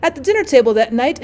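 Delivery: plain reading voice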